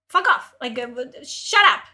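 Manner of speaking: loud, fed-up burst of anger; angry outburst. Real, not acted. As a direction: tell them off sharply. Angry reaction to article.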